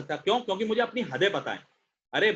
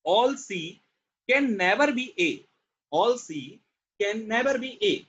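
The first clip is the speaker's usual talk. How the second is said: slow deliberate reading; much slower than usual. Shown as a demonstration. Teacher reading the rule to students, slow and deliberate. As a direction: read it word by word, slowly and clearly. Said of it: "अब सुनो".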